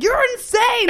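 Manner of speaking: high, loud mock outrage; retold mad outburst. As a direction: imitate an exasperated partner exploding. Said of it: "he was so mad".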